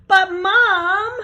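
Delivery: high, loud gasped plea; panicked child calling mom; gasping. Acted. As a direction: call out in alarm. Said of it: "gasped Eddie".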